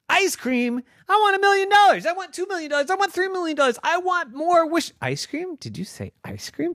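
put-on very high, loud indignant girl voice; outraged girl Noah. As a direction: squeal the protest like a spoiled kid. Noah outraged about ice cream.

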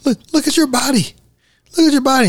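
high, urgent imitation of a freaked-out husband; lightly voiced panicky plea; mock panic. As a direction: plead it in a hammed-up fright.

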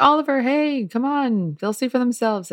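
family cutting in on Oliver; family interrupting. Retold or imitated. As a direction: nudge Oliver to wrap it up. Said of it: "saying things like".

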